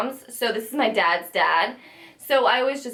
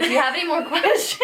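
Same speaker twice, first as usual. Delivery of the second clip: retold question cracking into laughter; higher, giggle through the quoted question; laughing quote; 6 semitones higher than usual.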